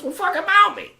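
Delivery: mock a coach losing patience; exasperated order. Tony's impatient order, acted out.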